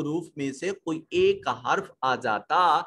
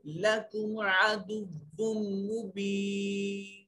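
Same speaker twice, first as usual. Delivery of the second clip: slow, drawn-out melodic tajweed chant; Quran recitation; 5 semitones higher, 4 dB quieter, much slower than usual. Shown as a demonstration. Teacher recites the verse for students before explaining, slow melodic chant. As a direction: intone each syllable long and measured.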